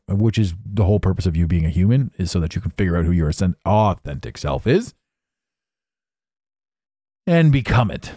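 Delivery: ordinary talk, nothing marked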